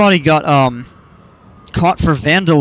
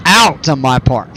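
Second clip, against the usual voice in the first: "out" punched louder with a falling tune; stress on OUT; 4 dB louder than usual. Shown as a demonstration. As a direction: hit the word "out" as if in capitals. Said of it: "capital O U T".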